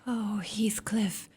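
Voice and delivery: soft, slow whispered name; whispered plea. Acted. Isabella pleading softly with her husband, whispered. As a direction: breathe his name, begging.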